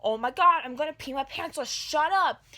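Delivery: loud, high, desperate outburst; panicked yell. Retold or imitated. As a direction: yell that you're about to wet yourself. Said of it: "I'm like".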